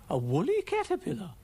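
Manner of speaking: high-pitched amazement; startled, high character voice; astonished exclamation. Acted. Ermyntrude exclaims at hairy Brian.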